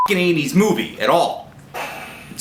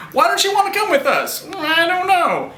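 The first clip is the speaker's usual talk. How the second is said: mock girly voice. Acted street exchange.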